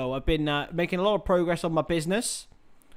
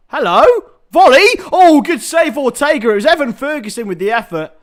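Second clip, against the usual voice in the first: loud, high burst of football commentary; excited match commentary; 7 semitones higher, 10 dB louder than usual. Real, not acted. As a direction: shout the shot, then ease down. Live call of a shot and save.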